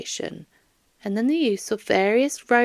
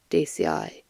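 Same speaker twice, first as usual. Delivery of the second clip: ordinary delivery, nothing marked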